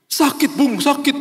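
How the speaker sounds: very high-pitched mock crying; hammed-up tearful complaint; imitated wailing. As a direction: wail the hurt out to a friend. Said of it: "Dia nangis meraung-raung".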